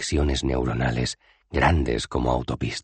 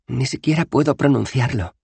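high, mildly whiny complaint; complaining girl; 11 semitones higher, 4 dB louder than usual. Acted. Bethan complaining about the word, mildly whiny. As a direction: grumble in frustration. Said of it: "se quejó la chica".

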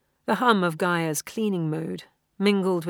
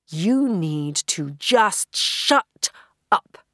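slow, louder, firm shut up; loud command; 7 dB louder, much slower than usual. A narrator's reading. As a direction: tell the voice firmly to stop. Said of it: "She spoke loudly".

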